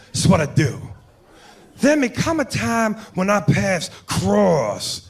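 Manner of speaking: slow, theatrical movie-cop impression; drawn-out tough detective with swooping emphasis; Al Pacino. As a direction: break it down slowly, punching key words. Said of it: "Pacino's sitting there with De Niro".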